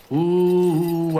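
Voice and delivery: a loud, drawn-out ooh; loud pained ooh. Acted. Soldier remembering the dying at war, loud ooh. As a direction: cry out a long ooh.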